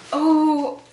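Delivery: surprised gasp. Real, not acted. Last item revealed.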